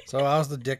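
ordinary delivery

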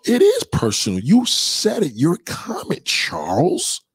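high-pitched, insistent comeback; worked-up, high-voiced rebuttal; indignant retort. Real, not acted. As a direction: argue back in a high, rising voice. Arguing back at a commenter.

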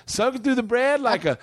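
high, stretched-out Southern rave; mock Southern family impression. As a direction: rave like a hammed-up Southern yokel. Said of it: "This is my impression of your family".

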